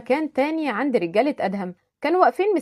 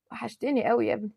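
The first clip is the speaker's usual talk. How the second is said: weak, faint voice; 7 dB quieter than usual. Acted. Dying mother speaks softly.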